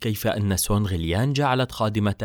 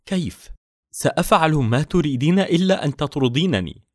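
fearful; 6 semitones higher, 4 dB louder than usual. A narrator's reading. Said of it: "قالت يان آر بخوف".

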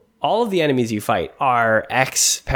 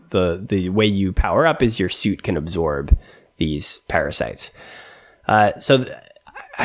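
normal talking voice

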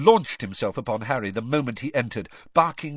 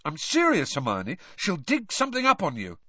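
higher-pitched, anxious pleading; nervous; 6 semitones higher than usual. A narrator's reading. Ron pleading with Hermione to be careful, nervous. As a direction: urge her to take care. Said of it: "said Ron nervously".

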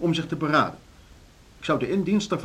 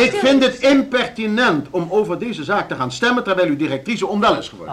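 higher and louder outrage; indignant protest; 7 semitones higher, 5 dB louder than usual. Acted. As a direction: scold the meeting. Chairman indignant at the vote.

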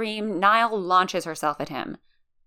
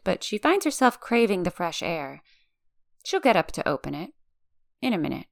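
usual speaking voice

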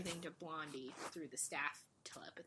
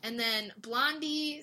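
normal talking voice

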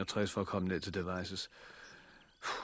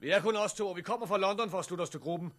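calling out; 11 semitones higher than usual. Acted. Calling to armed guard.

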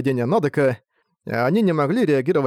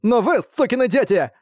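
shouting while sobbing; 9 semitones higher than usual. Acted. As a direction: yell it through sobs at classmates. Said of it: "прокричала, рыдая".

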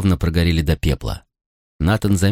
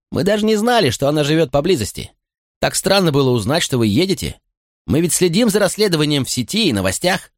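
put-on high, eager young officer; young man voice; 9 semitones higher than usual. A narrator's reading. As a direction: voice a boyish, keen policeman. Young policeman voiced by narrator.

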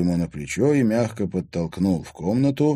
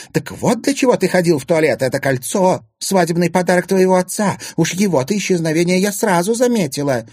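loud, high, indignant tirade; angry accusation; 11 semitones higher, 6 dB louder than usual. A narrator's reading. Stepmother accusing stepson of stealing the ring, furious. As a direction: pile up the charges in outrage.

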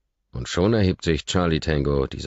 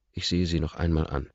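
ordinary delivery, nothing marked

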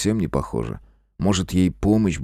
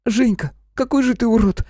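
put-on high girlish outburst; high-pitched, indignant little sister; squeal. A narrator's reading. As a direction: squeal at your big brother. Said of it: "взвизгнула неожиданно вернувшаяся Наташка".

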